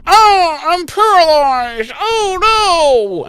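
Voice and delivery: high, mock-dramatic cry of paralysis; hammed-up panic. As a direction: overplay the helpless victim. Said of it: "she's like".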